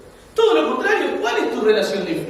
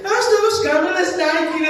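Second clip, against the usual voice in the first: hammed-up fake Portuguese accent, a bit higher; 6 semitones higher than usual. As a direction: put on a bad Portuguese accent for a shop clerk. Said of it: "lo único que hizo fue acento, acento portugués". (acento portugués falso y exagerado)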